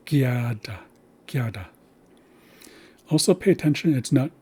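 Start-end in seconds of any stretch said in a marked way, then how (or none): 0.1-1.7 Gyada whispered with gya stressed, then gyada said aloud; first syllable stressed. Shown as a demonstration. Teacher repeating Gyada with first-syllable stress. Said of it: "so it's gyada".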